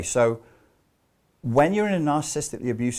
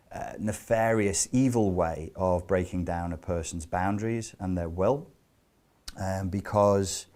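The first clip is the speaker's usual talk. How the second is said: normal talking voice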